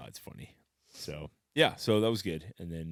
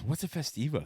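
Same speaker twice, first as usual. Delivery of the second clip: put-on kid voice; 8 semitones higher than usual. Voicing the thirteen-year-old son.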